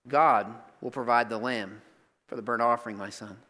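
low, soft father reassuring his son; tender father. Acted. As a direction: hush it gently to the boy. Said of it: "Abraham says".

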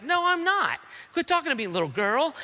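high-pitched mock outburst; hammed-up flustered brush-off; defensive denial. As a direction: snap back in exaggerated indignation. Peter snapping at little girl.

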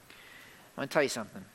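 low, hushed lead-in; soft, intimate preacher's aside; confiding aside. Real, not acted. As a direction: drop the voice and draw them in.

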